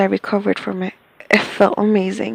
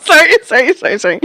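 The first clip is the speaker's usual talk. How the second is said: laughing apology; 13 semitones higher, 6 dB louder, faster than usual. Real, not acted.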